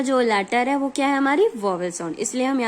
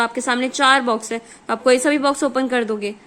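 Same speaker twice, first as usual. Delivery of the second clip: usual speaking voice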